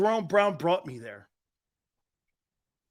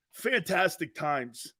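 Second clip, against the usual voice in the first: usual speaking voice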